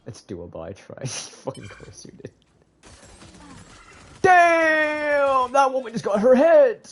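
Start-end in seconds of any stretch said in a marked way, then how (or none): 4.2-6.9 loud high shocked yell; shocked shout. Real, not acted. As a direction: shout out in disbelief. Shocked outburst at game.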